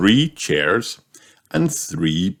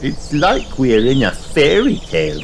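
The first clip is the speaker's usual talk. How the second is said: Peppa in wonder; 7 semitones higher, 3 dB louder than usual. A narrator's reading.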